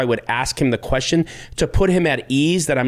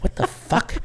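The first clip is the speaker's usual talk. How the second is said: whispered thought; 4 dB quieter than usual. Retold or imitated. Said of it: "he looked at me like".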